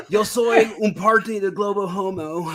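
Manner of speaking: put-on high, loud, slow American-accented Spanish; hammed-up presidential impression in Spanish; George Bush. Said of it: "my George Bush in Spanish".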